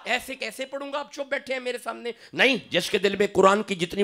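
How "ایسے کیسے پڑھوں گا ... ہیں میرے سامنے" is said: high, soft, laughing rebuke; playful laughing complaint, higher and softer; laughing tease. Real, not acted. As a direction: chuckle at the quiet crowd. Teasing silent audience, laughing.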